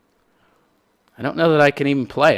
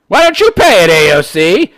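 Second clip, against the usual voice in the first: mock heckler; 8 semitones higher, 10 dB louder than usual. Voicing a heckler at AOC.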